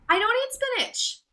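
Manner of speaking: indignant. Acted. Indignant correction.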